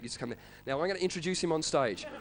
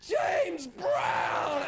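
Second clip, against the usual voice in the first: mock [name] shout of his own name; [name] yell; 10 semitones higher than usual. Mimicking [name] yelling his name at the audience, mock shout. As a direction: bellow your own name mid-song. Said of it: "just goes".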